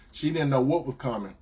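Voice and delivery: normal talking voice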